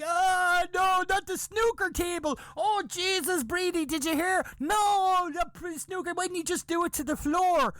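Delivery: high-pitched, hammed-up horror; mock-distraught women, pitch way up; freaking out. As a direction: wail like scandalized women. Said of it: "Women were freaking out".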